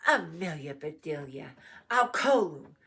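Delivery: put-on loud call to misbehaving children; scolding shout. A narrator's reading. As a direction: shout each name in reproach. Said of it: "shouted Missus Rogers".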